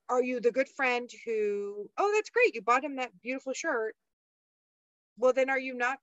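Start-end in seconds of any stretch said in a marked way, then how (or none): 2.0-3.9 high, gushing fake enthusiasm; mock gushing praise. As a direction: overdo the sugary cheer like a yes-man friend. Mocking the overly supportive friend.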